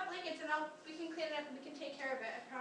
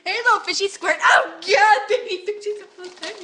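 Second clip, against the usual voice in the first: very loud, high squealing; excited squeal; 5 semitones higher, 22 dB louder than usual. Real, not acted. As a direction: squeal over the tiny fish. Squealing at baby fish.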